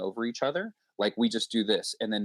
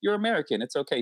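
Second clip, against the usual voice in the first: higher, lightly voiced forgiving guest; easygoing guest; 6 semitones higher, faster than usual. Canadian guest forgiving the American host, lightly voiced. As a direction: brush it off kindly.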